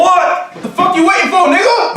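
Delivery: very high, loud playful badgering; hammed-up high-pitched holler; mock outraged shout. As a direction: yell at viewers in mock impatience. Mock badgering viewers in outro.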